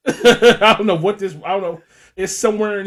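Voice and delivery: words pushed out through loud laughter; can't stop laughing while talking. Real, not acted. Cracking up at the porn story.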